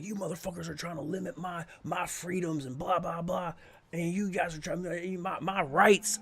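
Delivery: high-pitched, held-back mock yell; mock screaming. Inmate ranting at the jail officer booking him, mock screaming. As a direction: imitate an irate rant without the volume. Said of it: "He was screaming his head off".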